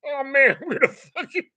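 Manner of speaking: high, dejected voice of a pitcher; imitating dejected pitcher. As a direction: sigh it out high as the losing pitcher. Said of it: "he came out going".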